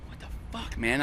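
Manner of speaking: hushed what the fuck; whispered. Retold or imitated. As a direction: whisper it in disbelief. Finds nobody behind RV.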